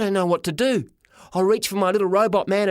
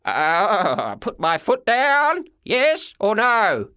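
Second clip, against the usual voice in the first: higher, slow frantic yell; Toothless yelling; 4 semitones higher, much slower than usual. A narrator's reading. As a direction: bellow it over the engine. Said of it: "yelled Toothless".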